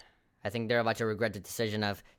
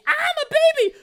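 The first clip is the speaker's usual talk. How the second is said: put-on very high, loud baby squeal; 11 dB louder than usual. Acted montage cry.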